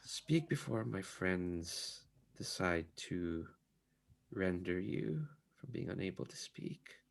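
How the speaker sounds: hoarse, hushed and menacing. Acted. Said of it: "a rather hoarse voice".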